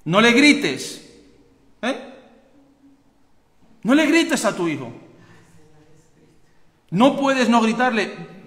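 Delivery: sermon thundered slowly; slow pulpit plea. Acted. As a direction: drive each word home to the parents. Preaching at full force.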